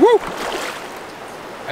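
high breathy whoop. Real, not acted. Celebrating his buddy's fish, a high whoop. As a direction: cheer the catch.

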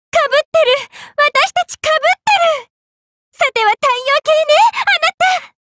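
very high, giddy outburst; excited realization. Acted. To a caster who overlaps with her, excited realization. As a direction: pitch it way up, thrilled. Excited at finding a look-alike character.

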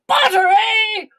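high, loud, drawn-out cry of delight; overjoyed king. A narrator's reading. King receives his butter, delighted. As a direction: crow it in triumph.

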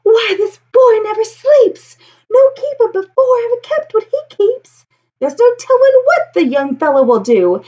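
high, astonished gasp of a crowd; gasping townsfolk. Acted. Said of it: "the whole town will gasp".

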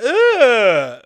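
high, loud imitated noise of rejection; super loud "ugh". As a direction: mimic her loud, drawn-out ugh. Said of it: "super loud".

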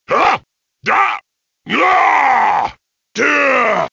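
sharp effort cries while taking and throwing hits; strained combat shouts; battle grunts. Acted. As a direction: grunt and yelp through the fight.

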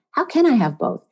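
"can" pushed up high, then falling away; stress on CAN. Shown as a demonstration. Host repeating the question for listeners, high stress on "can". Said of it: "With the emphasis on the can".

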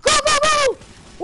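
high, loud and drawn-out go go go; screaming at teammates to push; high-pitched shriek of go; excited yelling. Real, not acted. Frantic gaming excitement.

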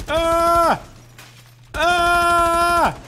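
long, high, loud screams; panicked scream. Real, not acted. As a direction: scream out as you are attacked. Attacked in game.

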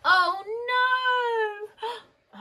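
very high, loud, drawn-out dismay; alarmed cry. A narrator's reading. The children find the dog gone, crying out in alarm. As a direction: wail it in storybook panic.

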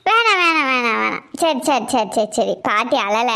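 mock-tearful granny hushing the child; grandma weeping. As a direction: sniffle it out, then wave the tears away. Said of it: "நீ அழாத".

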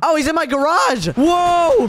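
high, loud panic; alarmed yell. Real, not acted. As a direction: shout in sudden alarm at an intruder. Enemy invades the garage.

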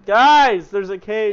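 loud high call to friends; yelling across the room. Real, not acted. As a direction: shout it out to get attention. Calling out to friends repeatedly.

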